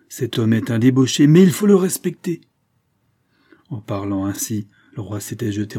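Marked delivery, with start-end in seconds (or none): 0.1-2.4 loud command climbing high; forceful insistence. A narrator's reading. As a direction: build to an emphatic royal order. King intervenes between two men.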